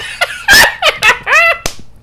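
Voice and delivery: high, loud burst of real laughter; loud laughing fit. Real, not acted. Loud reaction to joke.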